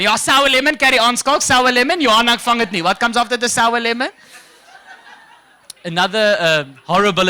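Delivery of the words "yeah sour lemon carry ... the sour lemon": cheering Afrikaans crowd. Retold or imitated. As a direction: hype him up like a mate at the bar. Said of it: "All the Afrikaans people going".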